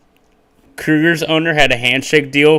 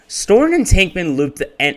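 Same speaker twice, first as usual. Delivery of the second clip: higher, upbeat video narration; YouTuber voice; 8 semitones higher, 5 dB louder than usual. Shown as a demonstration. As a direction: read it like an energetic YouTube narrator. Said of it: "Let's get YouTuber voice going".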